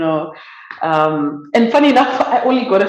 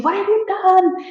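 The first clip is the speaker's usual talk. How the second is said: crowd shouting; 12 semitones higher than usual. Retold or imitated. Bystanders at the fuel station shouting at the driver, high accusing yell. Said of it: "people start shouting".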